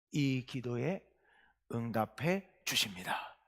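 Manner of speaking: slow, hushed whisper; whispered reveal. Acted. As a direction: drop to a secret whisper. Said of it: "hushed reveal".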